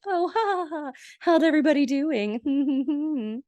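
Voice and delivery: high, singsong mock cheer; bouncy couple. Happy couple greeting everyone next morning, high mock-chipper voice. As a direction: imitate an overly chipper pair with fake laughter. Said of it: "Ross and Bonnie bounce down the stairs like".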